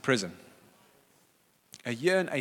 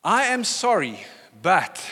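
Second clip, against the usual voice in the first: high, loud, grudging apology; slow, over-stressed mock apology; non-apology; 5 semitones higher, 5 dB louder, slower than usual. Shown as a demonstration. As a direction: lead forcefully into a defensive BUT. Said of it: "That's also not an apology".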